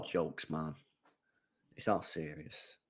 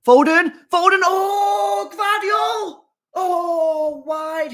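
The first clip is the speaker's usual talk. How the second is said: much higher and louder, rising yells of hope; excited shout; 5 dB louder, much slower than usual. Real, not acted. Fan yelling at a goal chance, excited shout. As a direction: scream the names as the chance builds.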